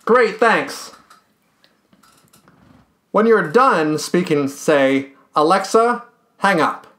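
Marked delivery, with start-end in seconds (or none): none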